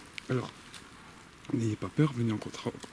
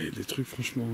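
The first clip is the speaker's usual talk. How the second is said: ordinary talk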